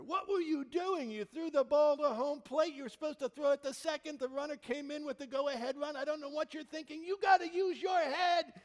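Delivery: high, loud mock tirade, fast in places; manager's rant. Tom Hanks unloading on his center fielder, loud angry rant. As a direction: chew her out like a cranky coach. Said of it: "he just unloads on her".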